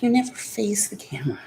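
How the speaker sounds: fast whisper. Real, not acted. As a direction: whisper it quickly to the pet. Whispering to pet.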